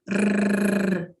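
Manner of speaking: slow trilled rrr; rolled r. Shown as a demonstration. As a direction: trill the tongue slowly. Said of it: "o R vibrante".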